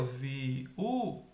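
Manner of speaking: "viúva" slowly as vi... ú... va; teacher spacing out "viúva", pausing between syllables; split into syllables. Shown as a demonstration. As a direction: break "viúva" into three separate syllables. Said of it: "já tá separado aqui".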